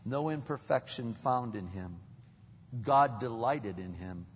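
normal talking voice

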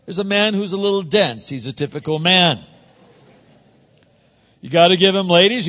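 ordinary talk, nothing marked

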